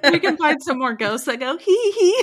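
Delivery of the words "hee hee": high, loud giggly ghost voice; giggling ghost. Pretend. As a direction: squeal like a cheeky little ghost.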